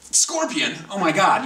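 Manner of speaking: "Scorpion?" shot up high and loud in alarm; a retold panicked yelp; freaking out. Hearing it might be a scorpion, alarmed and loud. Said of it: "I started freaking out".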